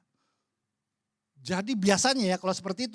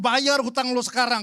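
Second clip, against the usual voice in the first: louder mock yell demanding payment; imagined yell; 5 semitones higher, 11 dB louder than usual. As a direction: bark it like a debt collector at a party. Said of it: "di pesta diteriakin". (teriakan pura-pura menagih utang)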